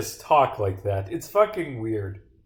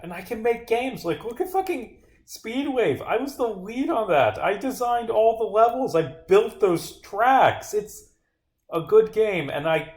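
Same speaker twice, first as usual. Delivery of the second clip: proud insistence; 9 semitones higher than usual. Real, not acted. Proudly defending own game work.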